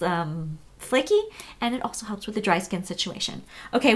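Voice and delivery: ordinary talk, nothing marked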